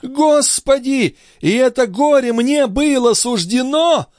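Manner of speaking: high anguished wail; frantic, very high lament; rising agitation. A narrator's reading. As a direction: cry to heaven in despair. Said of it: "возопила девушка со всё возраставшим возбуждением".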